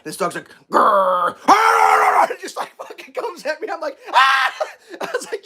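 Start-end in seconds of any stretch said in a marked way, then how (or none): 0.7-2.3 wild dog noises, rising high; mock dog attack snarl. 4.1-4.5 high, loud yelp of alarm; short mock scream; retold startled scream. His scream as dog attacks.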